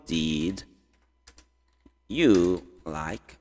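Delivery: slow and low, long pause after "Did"; word by word. Shown as a demonstration. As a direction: separate "Did" from "you like". Teacher builds question word by word. (bem devagar, grave, com pausa longa)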